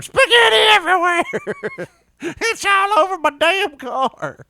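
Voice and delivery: very high, loud, slowed whining yell; shrill wailing complaint. Retold or imitated. Josh imagined wailing about his car, mock high yell. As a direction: wail it like a ruined man. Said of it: "Josh in the background going".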